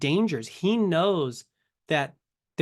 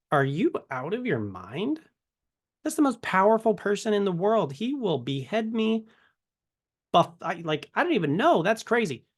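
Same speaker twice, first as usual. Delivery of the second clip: higher, rising mock alarm; mock panic; 6 semitones higher than usual. Moses balking at going to Pharaoh, hammed-up panic. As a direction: play Moses freaking out at the order.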